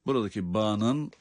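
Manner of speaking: ordinary delivery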